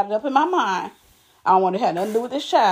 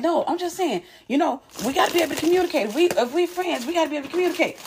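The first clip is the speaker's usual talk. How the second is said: higher, imitating a friend lecturing; friend's lecturing voice; 6 semitones higher, faster than usual. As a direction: mimic her preachy explaining. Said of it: "So she's like".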